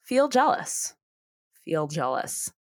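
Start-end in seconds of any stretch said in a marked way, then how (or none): 1.6-2.5 softer echo. Shown as a demonstration. Echoing the advice, Feel jealous said low and soft. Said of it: "You don't have to feel guilty".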